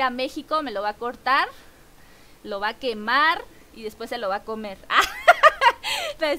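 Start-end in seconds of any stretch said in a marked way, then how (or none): 4.8-6.2 high, loud squealing laugh; high burst of laughter. Real, not acted. Streamer laughs at the viewer's mole joke, high and loud. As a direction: break into a shrill giggle.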